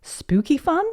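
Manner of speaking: put-on high, rising, hopeful little girl; child's question. A narrator's reading. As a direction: ask high with a rise. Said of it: "asked Peppa".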